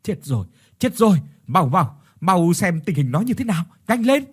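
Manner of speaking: loud, high alarmed cry; urgent panic. A narrator's reading. Mother-in-law fearing the wife is dead, panicked. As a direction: urge them on in a panic. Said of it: "vội vàng lên tiếng".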